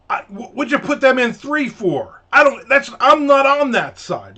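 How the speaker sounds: high, loud mock squabble; retold yelling. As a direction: yell it like a bickering waitress. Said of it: "you're just yelling at each other".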